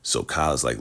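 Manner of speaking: ordinary delivery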